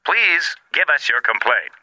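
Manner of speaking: slow automated hotline voice; phone menu voice. Acted. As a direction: read it like a recorded menu.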